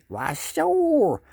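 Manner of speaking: high, loud fish voice for the wife; wife answers like the fish; goofy fish voice from the wife. Pretend. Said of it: "And she might say".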